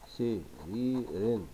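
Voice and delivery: "سرير" slowly split into its syllables; very slow, separated "سرير". Shown as a demonstration. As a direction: dictate "سرير" piece by piece as you write. Dictates word while writing.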